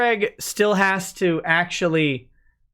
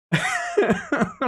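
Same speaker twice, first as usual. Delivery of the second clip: very high squeak of a laugh; a sudden high giggle; squeaky laugh. Real, not acted. Streamer breaking into a giggle mid-sentence, high squeak.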